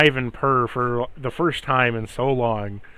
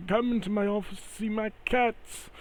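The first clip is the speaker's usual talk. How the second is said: higher, softer eager invite; lightly voiced eager host; 8 semitones higher, 6 dB quieter than usual. NPC invites Balsam to see his cats, eager. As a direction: lure him in to see the cats.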